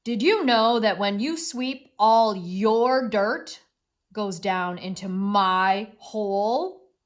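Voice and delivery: loud, slow, heavily stressed accusation; indignant read-aloud mole, drawn-out key words; angry mole. Acted. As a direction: hammer the words out as a cross storybook mole, ending on a question. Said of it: "This made mole angry".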